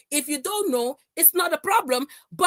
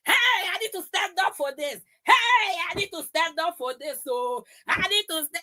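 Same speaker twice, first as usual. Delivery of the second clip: high, fast, angry outburst; she's boiling over and can't sit still; worked-up shouting; 4 semitones higher than usual. Real, not acted. Streamer fired up against her rival, shouting. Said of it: "I'm a little bit high in temper".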